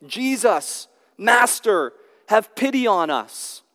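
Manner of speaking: calling out loud. Retold or imitated. Lepers begging Jesus from a distance, a high pleading call. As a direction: call out to him from afar. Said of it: "called out in a loud voice".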